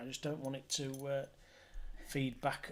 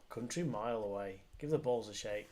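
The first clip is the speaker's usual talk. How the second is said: usual speaking voice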